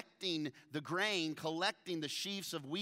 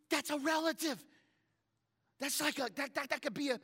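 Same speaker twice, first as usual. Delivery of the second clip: high, breathless excited Naomi voice; 12 semitones higher, 4 dB louder than usual. Retold or imitated. Naomi explains to Ruth that Boaz is a relative, high and excited. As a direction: gush like she's already planning the wedding. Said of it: "She said, she said".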